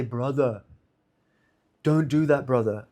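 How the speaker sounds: imitating a hushed, low-pitched brotherly caution; softly voiced mock counsel; soft admonishing. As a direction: quietly mimic someone discouraging a friend. Retelling a gentle admonishment.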